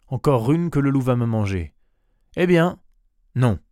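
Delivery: loud, rising protest; indignant outburst. A narrator's reading. Goat's owner refuses to lose another, indignant outburst. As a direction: burst out, peaking high and loud.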